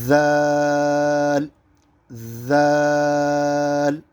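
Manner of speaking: ذال opening on a light voiced th, vowel stretched long; tongue past the teeth, draw out ذال; light lisped ذال. Shown as a demonstration. Teacher demonstrating the letter, ذال lightly buzzed and stretched. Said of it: "ہلکی سی آواز نکالیں گے".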